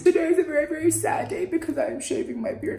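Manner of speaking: mock sad. Mock mourning over shaving the beard.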